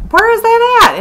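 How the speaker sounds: sudden panic over the missing candle; high alarmed where-is-it; alarmed question. Retold or imitated.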